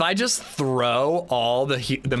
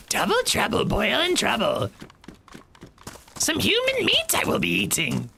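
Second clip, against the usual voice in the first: put-on high cackling old hag; witch voice; 9 semitones higher than usual. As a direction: croon it like a wicked witch. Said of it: "Let's try witch voice".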